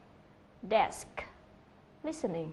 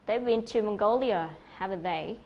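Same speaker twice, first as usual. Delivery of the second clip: "Mongolia" punched louder, "haven't they" dropping back; stress on MONGOLIA; 3 dB louder than usual. Shown as a demonstration. Teacher reads the example question with "Mongolia" most strongly stressed. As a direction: make "Mongolia" the clearest word of the question. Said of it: "cái từ mà được nhấn rõ nhất".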